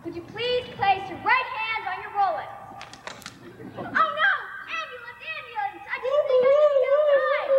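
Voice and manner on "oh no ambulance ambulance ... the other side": high, loud panicked yelling; panicked shout. Acted. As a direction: cry out in sudden alarm. Sudden crash panic.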